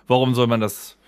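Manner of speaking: usual speaking voice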